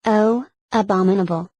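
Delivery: ordinary delivery, nothing marked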